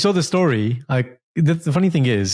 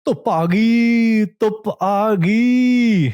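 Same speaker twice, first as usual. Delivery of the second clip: excited announcement; 8 semitones higher, slower than usual. Real, not acted. Announcing the sunshine.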